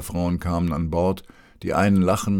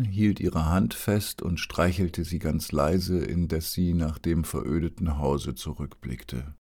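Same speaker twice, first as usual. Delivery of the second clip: ordinary delivery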